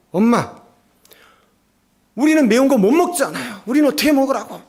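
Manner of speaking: mock a sulky kid grumbling at mom; whiny complaint. Children whining to mom about spicy stew, high and loud. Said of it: "짜증을 내면서 막 불평을 하는".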